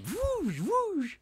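very high swooshing sound; mock whoosh. Mimicking his snowboard runs, high and playful. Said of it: "I'm all like".

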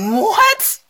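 high, incredulous what; reliving her disbelief; retold shock. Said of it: "I said".

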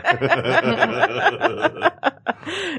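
long, high, hearty real laughter. Real, not acted. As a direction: laugh hard at your own joke. Guest laughing at his joke.

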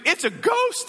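high-pitched cry of mild alarm; fearful cry. Acted. Disciples seeing Jesus on the lake, acted fearful cry. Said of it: "cried out in fear".

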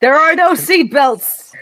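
high-pitched alarmed cry; acted panic, pitched high; hollered protest. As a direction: holler a protest at the reckless pilot. Acting panicked navigator in flight.

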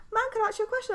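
high-pitched mock student asking permission; student's voice. Student asks teacher if she can ask a question, imitated high voice.

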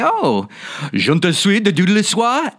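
high-pitched mock French gibberish; mock French speaker. French people answering an actor's attempt, high mock French. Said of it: "the French people say".

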